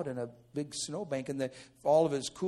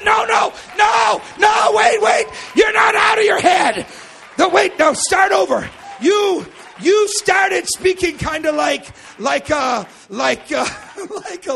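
high, shouted objection; excited interruption; 16 dB louder than usual. Acted. Preacher stopping brother on the phone, excited shouting. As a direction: yell to halt and restart the story.

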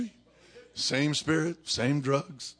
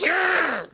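loud, high imitated dog snarl; dog growl; 8 dB louder than usual. Preacher imitating the dog when somebody gets close, growling. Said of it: "the dog goes".

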